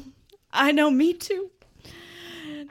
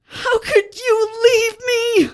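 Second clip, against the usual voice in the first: higher and louder, slow and tearful; over-the-top crying reproach; hammed-up sobbing; 8 semitones higher, 8 dB louder, slower than usual. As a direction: wail it like a melodrama.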